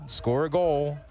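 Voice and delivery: normal talking voice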